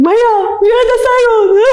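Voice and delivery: high, hammed-up tearful wail; imitated crying. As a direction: call the driver through mock sobs. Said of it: "And I'd be crying".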